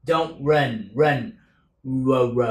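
ordinary delivery

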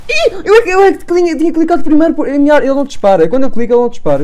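high, loud aggrieved protest; indignant complaint. Real, not acted. Player protests to viewers that his gun won't fire. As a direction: complain hotly the gun failed.

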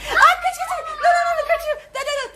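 high, laughing shouts; excited panic. Real, not acted. Dad wakes, chase panic. (gülerek heyecanlı bağırış)

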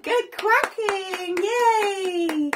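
normal talking voice

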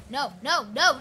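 usual speaking voice

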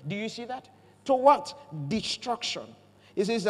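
normal talking voice

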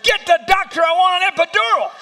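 ferocious demand. Retold or imitated. Wife in labor screaming at husband for epidural. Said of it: "She said".

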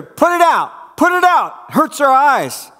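high, loud frantic cry to put the fire out; panicked shout. Acted. Cave people beg the stranger to douse the fire, panicked high shout. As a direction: yell in pain and fear at the light.

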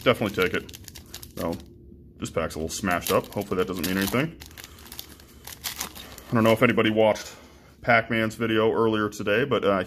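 normal talking voice

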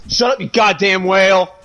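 exasperated yell. Real, not acted. Can't do anything with her yelling, exasperated yell. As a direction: yell to silence the noise. Snaps at someone who keeps yelling.

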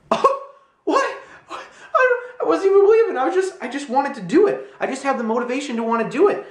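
very high, squeaky acted-out astonishment; stunned disbelief. Looking at the money that changed, stunned disbelief. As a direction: babble it in high-pitched amazement.

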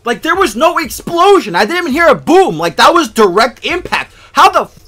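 loud, high, outraged arguing; incredulous protest. Real, not acted. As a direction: protest the unfair miss, voice way up. Incredulous rage at game.

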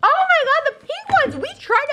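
high-pitched delighted squeal; excited squeal. Real, not acted. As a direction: gush over a surprise gift. Sees the pink cookies.

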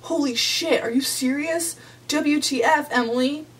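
put-on high-pitched, incredulous boyfriend outburst; mock mad. Boyfriend angry at Emily for cancelling, mad. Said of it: "And then he gets mad".

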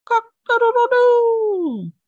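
very high, loud, drawn-out crowing; rooster crow. A narrator's reading. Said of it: "By the time the rooster crowed".

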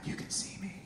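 hushed ghostly "You can see me"; awed whisper. Retold or imitated. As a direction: breathe it like a spooked ghost. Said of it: "the person would be like".

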